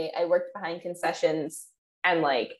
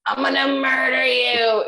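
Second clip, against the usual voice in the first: slow, loud mock threat; playful fake menace, shouted slowly; mock murderous shout; 4 semitones higher, 5 dB louder than usual. Shown as a demonstration. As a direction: bellow it like a cartoon villain. Mock death threat to pet.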